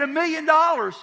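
loud, very high, incredulous question; retold high-pitched disbelief; astonished exclamation. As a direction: blurt it out in mock shock. Agent astonished at the amount.

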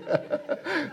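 a sudden high-pitched giggle; short embarrassed squeal of a laugh; squeaky high laugh. Real, not acted. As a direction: laugh at your own guilty confession. Confessing sick-day joy.

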